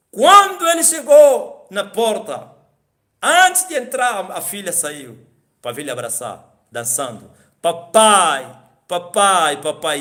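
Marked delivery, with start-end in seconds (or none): 0.1-4.3 slow, high, loud proclamation; loud slow preaching. Real, not acted. Pregação em voz alta e lenta. 7.6-10.0 a hint of a joyful girl calling papa; daughter calling out. Daughter runs calling father.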